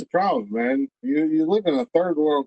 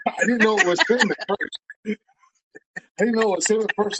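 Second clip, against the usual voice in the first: ordinary talk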